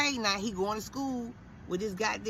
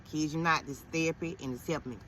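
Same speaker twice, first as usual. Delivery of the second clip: ordinary talk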